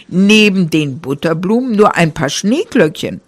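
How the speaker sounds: ordinary delivery, nothing marked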